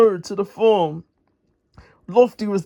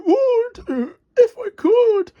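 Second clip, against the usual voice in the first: very high, crying voice; high, tearful splutter of a crane; spluttering Lofty; 14 semitones higher than usual. Acted. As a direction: sputter it out as the struggling crane. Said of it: "spluttered poor Lofty".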